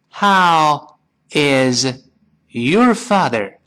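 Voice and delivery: slowly, word by word, each one separate; unhurried textbook reading, 'is' kept in full; slow careful model. Shown as a demonstration. Teacher models the full question for learners, slow careful model. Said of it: "快一些".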